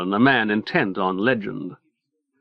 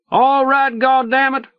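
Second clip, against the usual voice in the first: high, fed-up shout; 13 semitones higher, 3 dB louder than usual. A narrator's reading. Leo gives up on the boat and shouts to his man, high angry shout. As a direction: yell it like the game is up. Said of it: "Leo is shouting".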